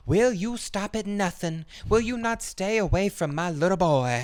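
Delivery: high pleading mother's voice, slowed; frantic mother. Retold or imitated. A mother at the door demanding her boy back, high and pleading. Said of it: "and she's like".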